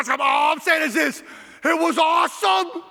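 high, drawn-out preacher's holler; exultant shout. Real, not acted. Preacher telling how the team came together, high exultant shout. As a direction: proclaim it slowly at a high pitch.

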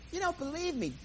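high, suspicious accusation; high, mock-accusing woman's voice; accusing busybody. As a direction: needle him like a nosy stranger. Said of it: "this New Jersey woman".

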